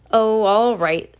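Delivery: slow, loud, grumbling grudging assent; grumpy. A narrator's reading. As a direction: drag it out like a sulky boss. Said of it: "Sir said grumpily".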